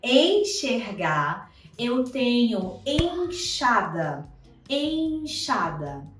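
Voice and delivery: enxada said slow, X as sh; teacher demo; slow model words. Shown as a demonstration. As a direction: draw out enxergar and enxada. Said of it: "o X com o som de C H".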